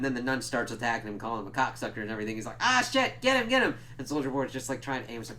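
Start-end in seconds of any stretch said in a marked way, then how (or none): 2.6-3.7 high-pitched, louder imitation of alarm; hammed-up frantic shout; mock panicked yell. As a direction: act out an urgent cry for help. Acting out character during attack.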